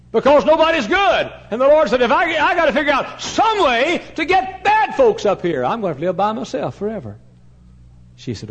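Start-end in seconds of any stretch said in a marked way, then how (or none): 0.1-7.2 high, loud pulpit declamation; full-force preaching. Acted. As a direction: preach it out as God's exasperated declaration. Preaches at full force, voicing God.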